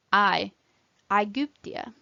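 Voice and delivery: "I", then "Aegyptia" opening on that same eye sound; AE diphthong. Shown as a demonstration. Teacher modelling a diphthong, "Aegyptia" starting like eye. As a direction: glide A and E into one sound, as in "Aegyptia". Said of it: "are pronounced as one sound".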